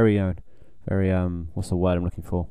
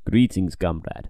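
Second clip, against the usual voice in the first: put-on slow, lower Russian accent; 5 semitones lower, slower than usual. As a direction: drawl it in a mock Russian accent. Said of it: "I can do a better one".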